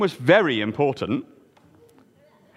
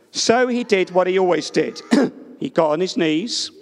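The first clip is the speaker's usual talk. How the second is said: plain reading voice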